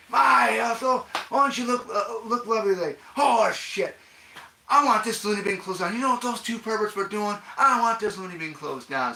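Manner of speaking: high, loud indignant holler; hollered film impression. Acting out the loony bin complaint, high and hollering.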